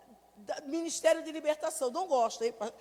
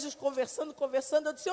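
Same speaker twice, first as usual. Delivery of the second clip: ordinary delivery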